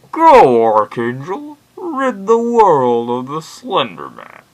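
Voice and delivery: loud, high, booming villain imitation; hammed-up, drawn-out battle cry; Bane. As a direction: proclaim it slowly like a theatrical supervillain. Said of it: "That's not my best Bane accent".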